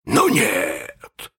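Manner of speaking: drawn-out villainous hiss; hissed through teeth. Acted. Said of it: "прошипел Крюк сквозь зубы".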